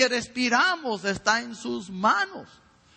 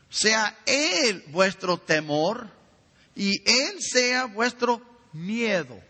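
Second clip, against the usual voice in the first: solemn scripture reading; 2 semitones higher, much slower than usual. Acted. Reading Isaiah to the congregation.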